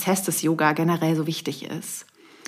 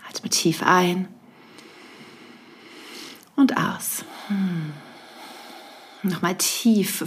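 guided meditation. Acted. Leads a breathing meditation.

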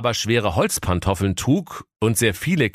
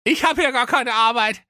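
high goofy prince voice; cheerful prince. Acted. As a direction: chirp it high and carefree.

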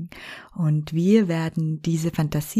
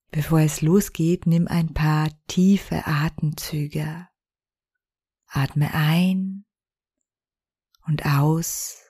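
guided meditation. Acted. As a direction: lead them gently into relaxation. Leads a fantasy journey meditation.

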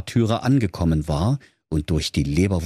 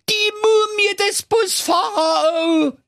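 high, slow, drawn-out scream of panic; terrified shout; 3 dB louder, slower than usual. Acted. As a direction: wail it out in full terror. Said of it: "schrie in heller Angst". (langgezogener Angstschrei)

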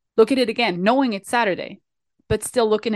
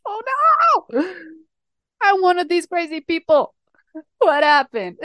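mock panic; 8 semitones higher than usual. Hearing her name paged at the airport, high hammed-up panic. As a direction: gasp it out, pretending to be horrified.

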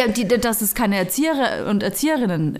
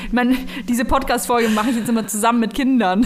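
giggling while talking; trying not to laugh mid-sentence; stifled giggles. Real, not acted. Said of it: "Hör auf zu lachen". (unterdrücktes Kichern)

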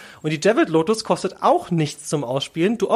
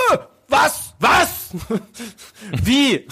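shocked disbelief; 5 semitones higher, much slower than usual. Retold or imitated. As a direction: squeak it out in disbelief. Mimics world's shocked reaction. (gespielt ungläubiges Entsetzen)

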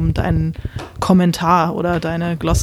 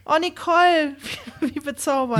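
delighted; 7 semitones higher, 4 dB quieter than usual. Real, not acted. Touched by a listener's message.

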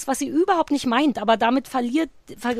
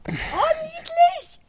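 high cooing delight; 3 dB louder, slower than usual. Real, not acted. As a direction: squeal oh so cute. Cooing over cute sneeze.

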